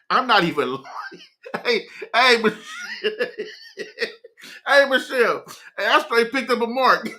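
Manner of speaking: cracking up, high-pitched laughter through the words; real laughing fit. Real, not acted. Telling listeners his own story, helpless with laughter. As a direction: barely get it out for laughing.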